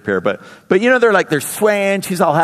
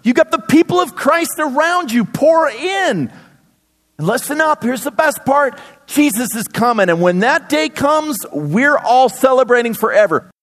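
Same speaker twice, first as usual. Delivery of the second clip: Paul cheering on his suffering church, raised and eager; pep-talk from an apostle, pitched higher; voicing Paul; 6 semitones higher than usual. Paul voiced encouraging the church.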